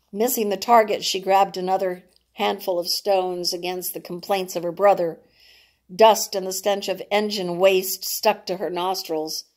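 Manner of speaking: ordinary delivery, nothing marked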